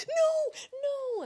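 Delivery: high, drawn-out protest; pleading no. Real, not acted. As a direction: take it back with a high no. Backtracking after teasing nerd remark.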